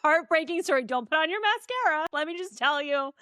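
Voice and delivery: lightly mock her gleeful rundown; playful teasing impression of a friend; imitating friend. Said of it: "Oh, she's like".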